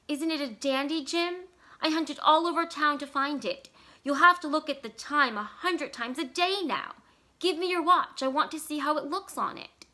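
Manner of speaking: bright eager showing-off. A narrator's reading. Della showing Jim the watch chain, eager and high. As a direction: hold out the gift, eager and high.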